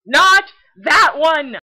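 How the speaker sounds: loud, high, frantic no; shouting correction. Real, not acted. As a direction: yell to stop the mistake. Correcting the wrong Dante clip.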